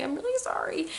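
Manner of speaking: high, small pleading voice; panicked apology, retold. Apologizing to mom over the dress, high panicky plea. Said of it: "Like, I'm panicking".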